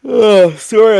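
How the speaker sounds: words stretched by a big loud yawn. Real, not acted. To viewers during the homeless interview, yawning through it. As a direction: apologize mid-yawn, mouth wide open. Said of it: "I'm yawning".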